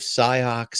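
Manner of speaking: "Sioux" misread as "SIGH-ocks"; misread spelling. Retold or imitated. Teen reading a sign to parents, "Sioux" as "sigh-ocks". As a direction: sound out "Sioux" by its letters, X included, "sigh-ocks". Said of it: "But it has an X in it".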